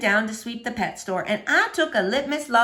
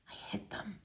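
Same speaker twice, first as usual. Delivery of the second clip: breathy, unvoiced confession; whisper; 3 semitones lower, 21 dB quieter than usual. A narrator's reading. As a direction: whisper it like a secret. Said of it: "he whispered".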